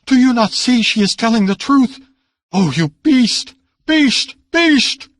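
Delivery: high, loud furious cries; enraged outburst. A narrator's reading. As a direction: hurl the words at him in rage. Niece raging at the uncle.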